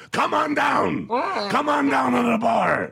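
mock bar owner yell. Imitating Red daring the caller, yelled high. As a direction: bellow come on down.

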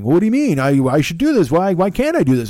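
put-on high, loud childish whining protest; whiny protest. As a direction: argue like a stubborn child wanting his way. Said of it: "She was tired of me going".

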